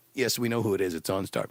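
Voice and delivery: low, soft operator reply; OnStar operator. Acted. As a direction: answer like a patient, subdued agent. Skit, voicing OnStar operator.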